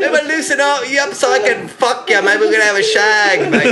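high, silly British-Irish lilt, easing down; mock British-Irish accent. Playing a wizard flirting with Hermione, mock British-Irish accent. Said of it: "That's Ireland, or what was that?".

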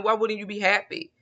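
ordinary talk

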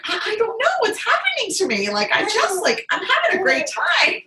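high, bubbly and bewildered, on the edge of laughter; re-enacted giddy disbelief; giddy excitement. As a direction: retell it in a high, thrilled voice. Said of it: "it was getting me excited".

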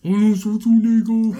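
hammed-up Austrian-accented name; mock Arnold accent.

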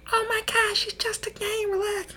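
very high-pitched dismissive voice; squeaky mock soothing; mocking imitation. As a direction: mimic someone telling you to chill. Mimics remark dismissing his game rage.

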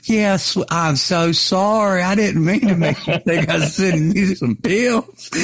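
high, slowed, sweetly apologetic; apologetic old lady. Retold or imitated. Old lady apologizing to Bo for the pill mix-up, high and sweet. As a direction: gush an embarrassed apology. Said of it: "She went".

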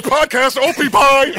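imitating buddy. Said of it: "He just goes".

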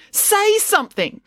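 very high, drawn-out urging; exasperated demand. A narrator's reading. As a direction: demand support from her brother, pitched right up. April turns on Joe.

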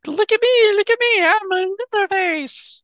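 high, loud cartoon squeak; squeaky vintage cartoon voice; cartoon character voice. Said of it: "he sounded like a cartoon character".

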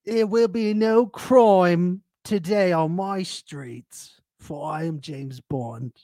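high, slow put-on British tough guy; mock British James Bond. Cohost plays James Bond warning the blokes, high, slow mock British accent. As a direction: ham up a Bond-style threat. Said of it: "I go up to those blokes and I say".

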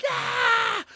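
high shocked scream of horror; a high mock scream of horror. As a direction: shriek out in horror. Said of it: "I screamed".

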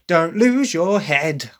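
punchy, slow, sharp line; English take, sharp and punchy; sharp English delivery. Shown as a demonstration. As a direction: snap it like a sassy teen. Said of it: "there's even a sharpness to that".

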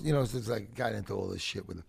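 normal talking voice

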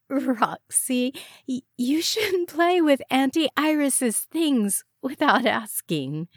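high-pitched, exaggerated scolding of a toddler; mock-stern scolding. A narrator's reading. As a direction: put on a stern mommy act. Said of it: "forced her lips into an exaggerated frown".

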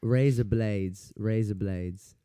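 slow, exaggerated mock Australian accent. Shown as a demonstration. Testing accents on co-host, hammed-up Australian accent. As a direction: try an Australian accent, drawing it out slowly. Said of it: "razor blades in an Australian accent".

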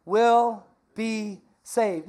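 will be saved stretched very slowly; slow call and response. Shown as a demonstration. Pastor leading the church in repeating the verse, slow. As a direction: draw each word out for the crowd to echo. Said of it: "Say it one more time".

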